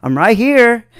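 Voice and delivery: mildly acted sarcastic reply. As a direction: answer back with light sarcasm. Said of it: "sarcastically said".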